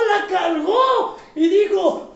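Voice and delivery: ordinary talk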